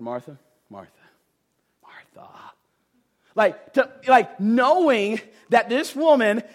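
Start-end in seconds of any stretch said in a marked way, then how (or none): 0.0-2.3 much softer, fading to a whisper on the last "Martha"; calm her down tenderly; patient, gentle. Retold or imitated. Jesus gently calming a troubled Martha, soft and fading to a whisper. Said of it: "He was patient with Martha".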